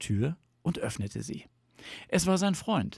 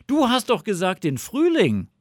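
high, loud protest; indignant fox; 11 semitones higher, 8 dB louder than usual. A narrator's reading. Fox catches Bear changing his mind, indignant. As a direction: object loudly in a high fox voice.

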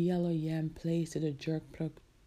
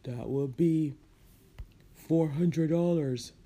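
weighty, drawn-out price announcement; seller naming price; 3 semitones lower, 4 dB louder than usual. A narrator's reading. Man charging Marcia for a soda, slow firm announcement. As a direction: announce the price slowly and firmly. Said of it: "The man said".

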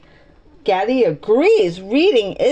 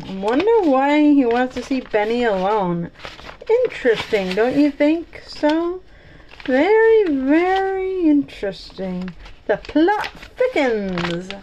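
mock intrigue; 3 semitones higher than usual. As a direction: savour the suspense, stretching the words. Reader's intrigued aside on story.

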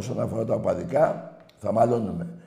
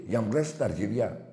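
ordinary talk, nothing marked

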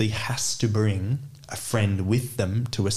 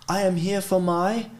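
raised pitch, deliberate English at the counter; clueless English-speaking customer; 8 semitones higher than usual. Acted. Showing a friend how to address officials, high and deliberate. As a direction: act the unsure customer, higher-pitched. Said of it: "go speak in English and be like".

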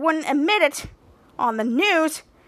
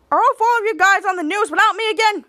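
loud complaint; 6 semitones higher, 5 dB louder than usual. Retold or imitated. Captain Man complains loudly.

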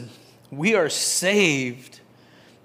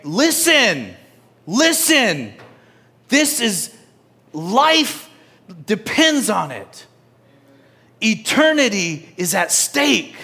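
higher and louder, hammering each phrase; urgent warning; 6 semitones higher, 4 dB louder, slower than usual. Retold or imitated. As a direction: hit them right in the jaw. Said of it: "that's what James is like... bam, right in your jaw".

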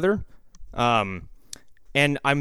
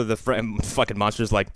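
usual speaking voice

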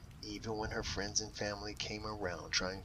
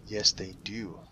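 ordinary delivery

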